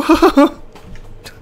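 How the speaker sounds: loud, very high laugh; high cackle. Pretend. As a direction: burst into a high-pitched laugh. Laugh ending the impression.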